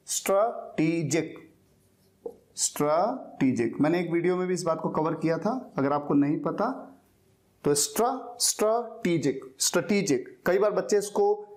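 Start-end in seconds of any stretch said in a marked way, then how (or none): 0.1-3.8 स्ट्रेटेजिक slowly split as "struh... TEE-jik"; slow correct pronunciation. Shown as a demonstration. Teacher modelling the word for learners, स्ट्रेटेजिक slowly as "struh, TEE-jik". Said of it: "इसकी pronunciation होती है". 7.7-10.4 स्ट्रेटेजिक built up from "struh" to "struh-TEE-jik"; syllable-by-syllable pronunciation. Shown as a demonstration. Teacher drilling learners who don't know it, स्ट्रेटेजिक as "struh... TEE-jik". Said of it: "इसकी pronunciation होती है".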